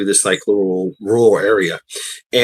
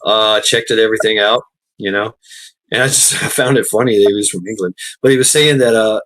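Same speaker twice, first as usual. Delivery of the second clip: ordinary talk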